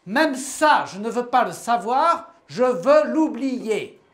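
loud and high, the last words slow; a furious shout at the world; outcry. Acted. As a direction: cry out in exasperation. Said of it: "et s'écria".

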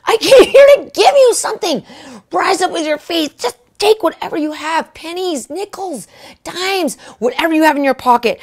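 high-pitched, fervent revival preaching; preaching with all my might. Retold or imitated. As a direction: preach like you're drilling it into them. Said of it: "I'm preaching it with all my might".

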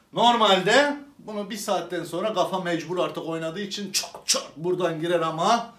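ordinary delivery

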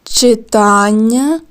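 Читання slowly, leaning on the middle syllable ТАН; stress shown. Shown as a demonstration. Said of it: "де стоїть наголос".

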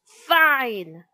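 loud, sharp dismissal; exasperated teacher. A narrator's reading. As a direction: cut the noisy class off.

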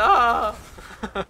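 sudden high burst of laughter; loud laugh. Real, not acted. Laughs at stunned boss.

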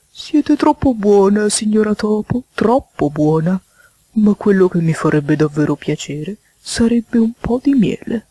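drawling, wheedling toad guest; toad character voice. Acted. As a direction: stretch the compliments out, then ask for a favour. Voices Mr. Jackson.

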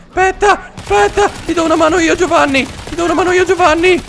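high, squealed urgency; frantic calling out. Real, not acted. Player offering Giovanni a hand, frantic calling out. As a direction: rush to a friend's rescue.

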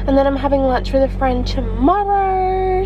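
ordinary talk, nothing marked

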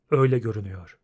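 low, resigned agreement; Geralt, calm. A narrator's reading. Witcher agreeing with his anxious friend, calm and low. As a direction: answer low and unruffled.

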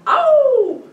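high, loud triumphant howl; wolf howl. A narrator's reading. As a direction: howl as you close in. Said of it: "Le loup hurle". (hurlement de loup aigu et fort)

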